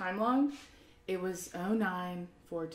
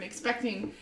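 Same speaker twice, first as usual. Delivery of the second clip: ordinary talk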